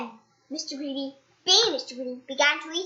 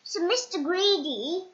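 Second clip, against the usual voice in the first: usual speaking voice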